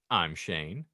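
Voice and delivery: usual speaking voice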